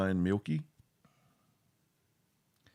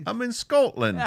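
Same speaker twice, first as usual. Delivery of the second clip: put-on a clumsy try at a Scottish accent, pitched way up; bad Scottish accent; 11 semitones higher, 6 dB louder than usual. Cohost tries Scottish accent on request, badly. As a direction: attempt a Scots accent and miss. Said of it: "that was a legit attempt".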